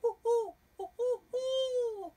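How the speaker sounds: high, loud owl hoots. Acted. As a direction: hoot like an owl. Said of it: "called Owl".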